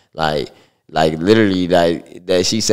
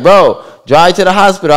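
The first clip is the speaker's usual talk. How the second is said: urgent demand; 12 semitones higher, 10 dB louder than usual. Retold or imitated. Friend urging, retold.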